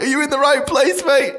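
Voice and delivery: high, loud incredulous "are you lost" question; puzzled stare question. Retold or imitated. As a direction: ask it like he wandered into the wrong room. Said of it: "looking at me like".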